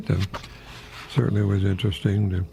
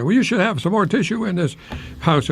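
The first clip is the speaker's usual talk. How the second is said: arguing spouse; 8 semitones higher than usual. Retold or imitated. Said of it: "you say".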